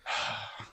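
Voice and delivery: long voiceless exhale; mock resigned sigh. Imitating a tow driver watching the car leave, resigned sigh. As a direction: let out a defeated sigh.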